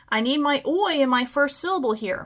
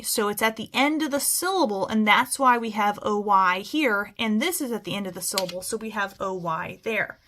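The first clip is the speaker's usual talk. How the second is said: ordinary delivery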